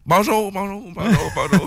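repeated bonjour fading like a mic echo; canyon echo. Pretend. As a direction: call bonjour into a canyon and let it bounce back. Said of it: "tu mets un effet de, d'écho dans son micro".

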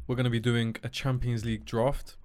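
ordinary delivery

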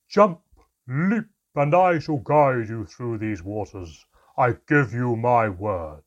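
loud, slow, far-off echoing voice; booming echo. A narrator's reading. The mermaid calling up to the frightened pair, slow loud boom. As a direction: boom it so it rains down from above. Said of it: "deep and powerful, but now loud".

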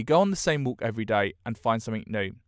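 normal talking voice

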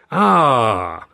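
a bright, loud exclamation; cried out. A narrator's reading. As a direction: call it out grandly. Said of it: "our host cried".

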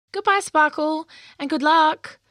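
a hint of calling out; calling goodbye. A narrator's reading. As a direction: call a warm farewell. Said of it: "called Meadow".

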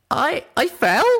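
high, loud stammered question; shocked disbelief. Acted. Little Pip learning she fell from the porch, shocked.

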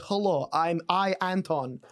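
put-on broken-English Russian accent. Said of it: "stereotypical impression of a Russian".

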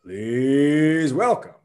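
booming wrestling ring announcer; grand entrance announcement. Acted. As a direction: stretch every name out like a ring announcer. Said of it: "This is what it needs to sound like".

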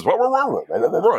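high, wordless mock DJ patter; radio guy babble. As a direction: babble like a radio host talking up a song. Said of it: "it's always like this".

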